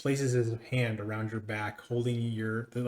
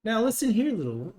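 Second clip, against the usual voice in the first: raised, loud opening that drops away; condescending scolding; 11 semitones higher, 5 dB louder than usual. Acted. Master rebukes the little one, condescending. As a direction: talk down sharply to an underling.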